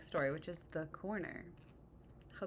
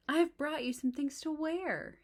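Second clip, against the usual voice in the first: cheerful; 9 semitones higher, 5 dB louder than usual. Acted. Frog arriving with clothes.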